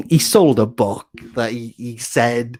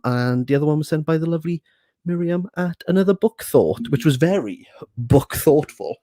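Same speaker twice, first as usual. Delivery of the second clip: ordinary talk, nothing marked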